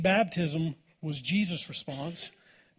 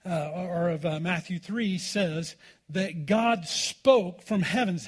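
normal talking voice

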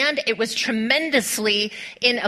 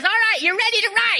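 pumped-up coach; 11 semitones higher, faster than usual. Retold or imitated. Instructor pumping up kid.

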